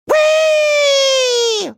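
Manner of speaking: long, high squeal of delight; gleeful whee. Acted. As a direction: squeal like on a thrill ride. Thrill ride cry.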